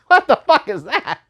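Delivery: high, disbelieving burst through laughter; incredulous laughing outburst. Retold or imitated. Reviewer retelling his reaction in the cinema, incredulous and laughing. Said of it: "I'm just".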